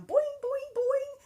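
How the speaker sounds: very high, loud springy boings; bouncy boing. A narrator's reading. Bouncing sound effect in story.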